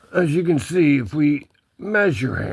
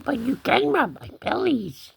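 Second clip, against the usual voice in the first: high put-on kitty talk; cat voice; 8 semitones higher, 3 dB quieter than usual. Cat inviting a belly rub, high cat voice. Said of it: "He says".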